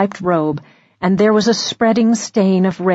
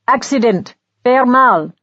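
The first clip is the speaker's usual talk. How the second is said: a hint of a French guard clearing the crowd; guard calls to crowd in French accent; 4 semitones higher than usual. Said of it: "said the guard in French".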